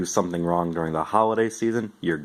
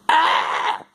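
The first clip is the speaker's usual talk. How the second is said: startled gasp; 4 dB louder than usual. Acted. Startled by the doll.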